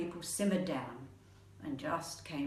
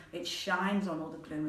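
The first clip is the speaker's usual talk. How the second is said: plain reading voice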